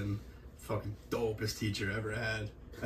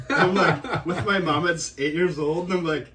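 ordinary talk

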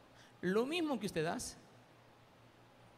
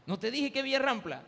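higher, louder exasperated question; mock scolding; 6 semitones higher, 5 dB louder than usual. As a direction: scold him playfully. Pastor scolding about the stairs.

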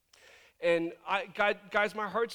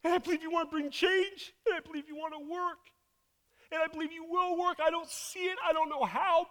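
high-pitched, fervent prayer; heartfelt declaration; 9 semitones higher than usual. Acted. Scared child of God praying to Dad, fervent and high. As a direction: pour out trust to a father, voice lifted high. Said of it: "He just wants hearts that say".

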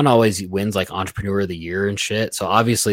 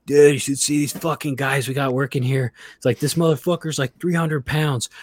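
higher, put-on gossiping voice; imitating a coworker; 7 semitones higher than usual. Imitating a coworker gossiping about new valet guys, higher voice. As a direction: mimic the coworker dishing about new hires.

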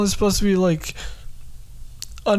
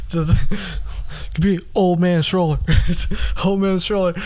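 ordinary delivery

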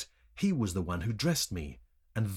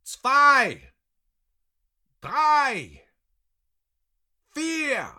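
very high, loud shout; one ringing, high yell; shouted count; 10 dB louder, much slower than usual. A narrator's reading. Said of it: "shouted the man who was keeping count".

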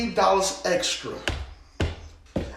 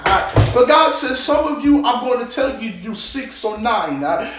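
loud, fervent preaching with tongues; preaching at full force; 2 semitones higher, 5 dB louder than usual. Acted. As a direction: drive the message home over the music.

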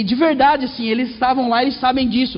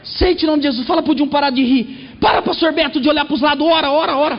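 bossy mother; 4 semitones higher than usual. Retold or imitated. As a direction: bark orders at everyone to pray. Mother ordering prayers.

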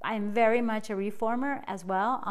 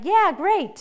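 chirpy yeah great; 8 semitones higher, 5 dB louder than usual. Retold or imitated. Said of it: "And I'm like".

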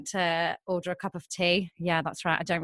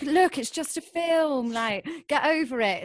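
exasperated dad; 6 semitones higher than usual. Retold or imitated. Dad dismissing his child's film fear, mock exasperated. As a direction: imitate a dad brushing off a child's fear, higher.

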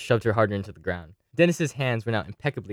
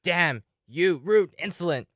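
higher, very slow indignant sputter; outraged cry; 8 semitones higher, slower than usual. Acted. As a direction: drag out the outrage. Denise struck, stumbling.

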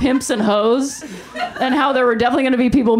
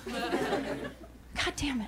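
under-breath curse; 18 dB quieter, much slower than usual. Real, not acted. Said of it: "muttered aside after rant".

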